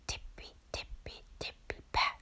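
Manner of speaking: very soft whisper; whispered hops. Acted. Bunnies quietly hop away from the wall, whispered. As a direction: tiptoe the words away.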